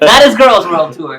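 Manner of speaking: loud laughing repeat. Real, not acted. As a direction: laugh out the jab again.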